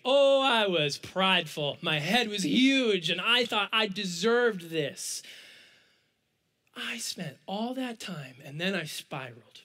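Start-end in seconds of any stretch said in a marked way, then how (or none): none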